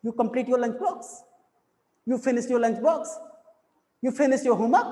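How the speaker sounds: last word lifted: box, homework; rising question tone. Shown as a demonstration. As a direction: let the tone climb on box and homework. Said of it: "homework में थोड़ा सा हम tone है वो automatically up जाने लगता".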